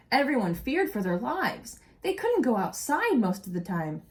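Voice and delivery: storybook narration for a class; expressive read-aloud story voice; reading aloud to children. A narrator's reading. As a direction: read it like a teacher sharing a picture book. Reading picture book aloud.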